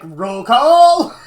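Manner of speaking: loud, high, gleeful yell; shouted punchline. Retold or imitated. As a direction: bellow it like a rallying cry. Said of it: "And I'm like".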